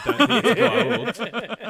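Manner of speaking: laughing through a mock quote; cracking up mid-imitation. Mocking Hammond's reply, laughing.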